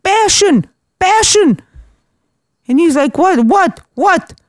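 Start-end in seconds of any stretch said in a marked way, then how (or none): 0.0-1.6 high, pleading mock yell; retold yelling. Woman yelling at her pinching date, mock exasperated yell. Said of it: "I'm yelling at him". 3.2-4.4 high, quick mock bafflement; baffled. Pinching date fails to understand her, mock baffled. Acting the date's baffled reply.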